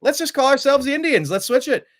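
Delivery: high, bright proud declaration a full step up; eager team voice. Retold or imitated. Team owners announcing the new name, high and proud.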